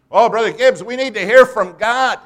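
loud, high-pitched imitation of pleading; mock pleading. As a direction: wail it loudly, mocking an earnest believer. Preacher mimics pleading church members.

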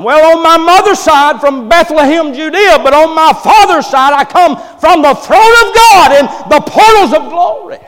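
high, loud proclaiming as Jesus; preaching at full force. Acted. Preacher voicing Jesus to the doctors, loud and proclaiming. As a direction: ring out each name like a herald.

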